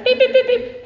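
high, even dialing beeps; imitated phone beeps. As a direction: mimic phone keys in a high pitch.

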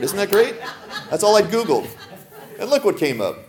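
laughing through the words. Real, not acted. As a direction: chuckle your way through it. Laughing at a silly slide.

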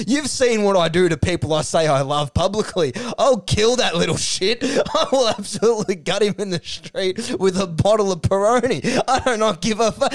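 high-pitched mock rant; hammed-up threats. Carey threatening the rookie he praised, hammed-up fury. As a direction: rage theatrically, pitched well up.

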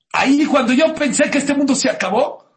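loud, high, ringing declaration; acted mother's fervent outcry; impassioned exclamation. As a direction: proclaim the turning point at full voice. Acted mother makes her emphatic point.

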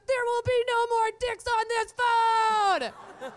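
loud, high, slow decree; dad shouting. Retold or imitated. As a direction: bellow it like a fed-up dad. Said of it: "and he goes".

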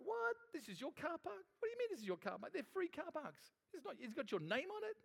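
high, incredulous inner protest; indignant disbelief. Retold or imitated. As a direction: retell the disbelief at the angry lady, pitched high. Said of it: "I thought".

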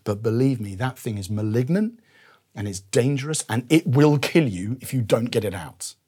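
quiet, earnest doctor's warning; a hint of a caring doctor warning gently; loving doctor. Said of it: "a loving doctor at that point would say".

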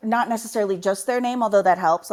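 ordinary talk, nothing marked

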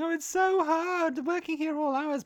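put-on high moan of hardship; mock complaint. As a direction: imitate them moaning about the work. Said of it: "they're still saying".

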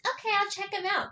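quick, high-pitched retold reply; imitating a high, fast yes; eager agreement. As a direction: chirp it quickly as someone readily agreeing. Retells Adrian's eager agreement.

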